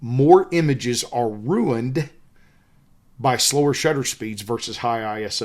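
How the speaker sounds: slow, careful restatement, each word spelled out; slow it right down so every word lands; slowed-down repeat for clarity over a Southern accent. Shown as a demonstration. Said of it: "Let me say that again because of my Southern accent".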